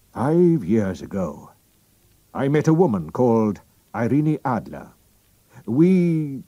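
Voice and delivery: a hint of a proud royal, pressing; drawing himself up, then urging secrecy; lightly voiced king. The king reveals his name to Holmes, lightly voiced.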